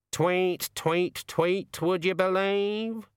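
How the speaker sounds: high, drawn-out raven voice; high raven voice, words stretched; talking raven. A narrator's reading. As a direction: croon it as a slow, high-pitched bird. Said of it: "said the raven".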